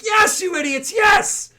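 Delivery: very high, loud, desperate shout; shrill pleading outburst under threat; exasperated hostage yell. Acted. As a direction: scream at your dim companions. Hostage yells at her companions to comply.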